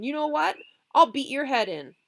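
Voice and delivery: loud, high threat; threatening. Acted. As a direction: threaten to beat him. Threat before fight.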